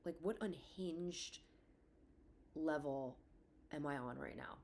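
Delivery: hushed, horrified whisper to herself; inner panic whisper. Retold or imitated. Mother whispering to herself after a dark joke, hushed and horrified. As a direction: whisper it as if appalled at your own words. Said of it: "I'm like".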